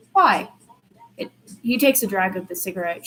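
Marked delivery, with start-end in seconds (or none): 0.1-0.5 sharp, high, loud disbelief; can't believe anyone would dump him; a stunned, indignant why; incredulous. A narrator's reading. Disbelief John was dumped.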